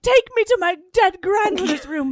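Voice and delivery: high, loud, hammed-up heroine voice; mock-pleading heroine. Suki asking Bill to carry her off, hammed-up high voice. Acting out Suki's demand to Bill.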